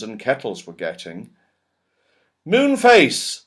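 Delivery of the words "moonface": high, loud call of a friend's name; calling out to Moonface; yelled name. Acted. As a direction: shout for him across the bumps. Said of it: "yelled Joe".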